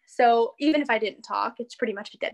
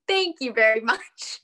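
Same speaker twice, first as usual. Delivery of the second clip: mock proud thanks; 4 dB louder than usual. Mock proud reply to locals.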